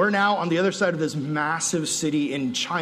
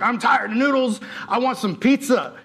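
whiny craving; 6 semitones higher than usual. Retold or imitated. Retold craving pizza.

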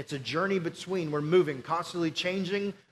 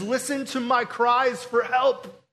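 slow, raised pleading plea; pleading cry; 5 semitones higher, 6 dB louder, slower than usual. Retold or imitated. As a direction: beg God to listen, slow and intense. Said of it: "He says".

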